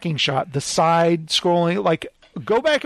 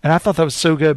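normal talking voice

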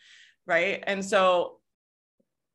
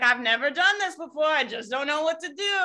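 high-pitched, exaggerated helpless complaint; hammed-up "poor me" protest; mock helplessness; 6 semitones higher than usual. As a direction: play up the clueless self-doubt, higher. Mocking a helpless thought.

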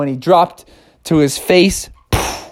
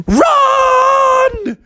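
shout; 3 dB louder than usual. Acted. Brothers warn Bubby.

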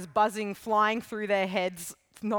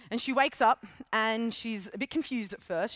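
normal talking voice

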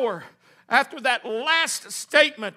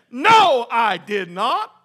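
emphatic denial; 6 dB louder, much slower than usual. Acted. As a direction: burst out on No, then drag out the rest. Peter's emphatic denial acted out.

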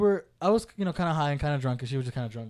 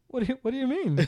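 higher, hesitant mock confusion; puzzled sister; 8 semitones higher than usual. Brother imitating his sister baffled by his joke, puzzled. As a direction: stammer it like someone honestly baffled. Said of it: "turned her head slightly to the side".

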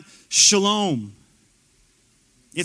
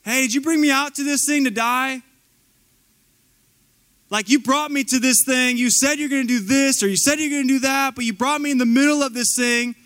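high-pitched protest, building louder; indignant complaint; 8 semitones higher, 4 dB louder than usual. Acted. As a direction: complain to God in an aggrieved, raised voice. Acts out believer complaining to God.

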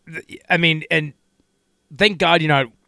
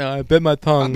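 mocking; 3 semitones lower than usual. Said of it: "but it's something".

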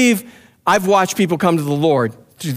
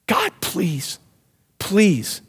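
pleading; 4 dB quieter, much slower than usual. Retold or imitated. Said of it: "somebody kept pleading for your soul".

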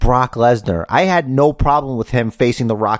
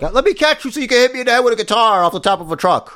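high, fast mocking voice; squeaky sarcastic wrestler impression; 12 semitones higher, faster than usual. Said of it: "It's like".

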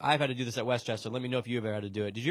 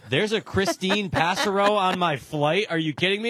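excited disbelief; 5 semitones higher, 5 dB louder than usual. Retold or imitated. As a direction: shout it like finding family. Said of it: "I go".

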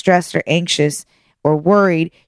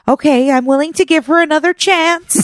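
high mock grudging voice; grudging old lady; 9 semitones higher than usual. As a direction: imitate her giving in, higher. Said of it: "she finally was like".

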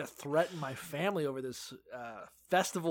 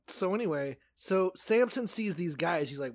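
ordinary delivery

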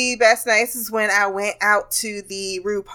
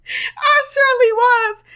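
mock whine; 4 dB louder than usual. Mock whining admission.

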